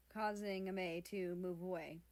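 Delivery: ordinary delivery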